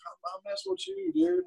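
mild mock reassurance; teasing. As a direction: hush it like a playful confession. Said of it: "And I was like".

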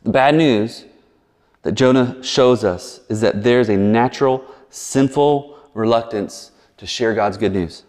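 ordinary talk

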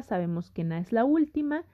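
ordinary delivery

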